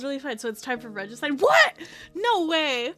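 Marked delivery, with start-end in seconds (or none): 1.4-2.9 loud, high burst of disbelief; shocked disbelief. Real, not acted. Streamer learning it's time for regicide, shocked.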